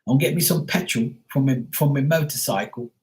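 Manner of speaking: put-on British voice; British accent. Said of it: "they say petrol".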